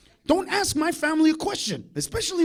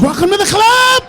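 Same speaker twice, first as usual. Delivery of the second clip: high, loud, gleeful yell; shouted welcome; 8 semitones higher, 12 dB louder than usual. Acted. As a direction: blast the welcome out at full volume.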